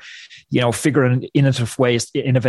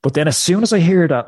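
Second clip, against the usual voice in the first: usual speaking voice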